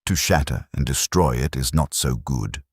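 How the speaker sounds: usual speaking voice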